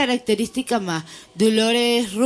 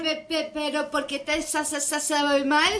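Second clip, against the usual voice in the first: mock stutter; 5 semitones higher, 6 dB quieter than usual. Mocking stutter after stutterer talk.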